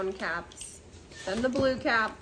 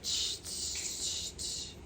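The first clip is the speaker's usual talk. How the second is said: mocking tsh; 5 dB quieter, slower than usual.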